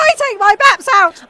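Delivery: high, loud boast; excited shout. Acted. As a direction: call it out high and hard. Actress calls out over her co-star.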